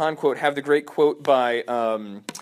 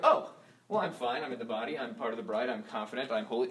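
soft, low-voiced self-satisfaction; mock complacency; 3 semitones lower, 9 dB quieter than usual. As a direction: play a believer content to do nothing. Mock complacent believer talking.

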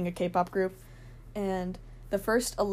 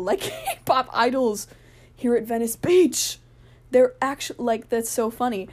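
giggling, louder, slow disbelief to her friend; laughing as she realizes they're real idols; excited; 3 semitones higher, 5 dB louder, slower than usual. Retold or imitated. Telling her friend Rhea about the K-pop idols, giggly and excited.

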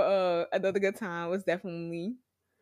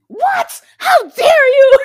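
high, loud mock outrage; hammed-up indignant shout; playful fake fury at a fan reaction; mock angry; 17 dB louder, much slower than usual. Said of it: "It's just angrily".

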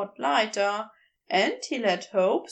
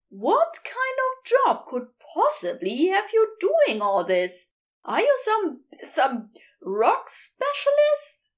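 higher, heated fussy angel voice; Aziraphale, wild; 8 semitones higher than usual. A narrator's reading. Angel ranting at the geologist, high and heated. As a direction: raise the pitch and let him rant.

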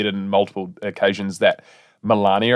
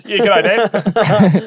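high, laughing Kiwi greeting; Kiwi bloke; 8 semitones higher, 4 dB louder, much slower than usual. Acted. Joking Trump son greets his dad, Kiwi bloke. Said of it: "this is Kiwi bloke".

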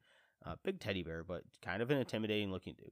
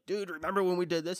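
higher, louder, fast excited ask; a higher, louder eager quote; 10 semitones higher, 6 dB louder, faster than usual. Retold or imitated. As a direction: hype your old friend up. Texting friend about Ghostbusters.

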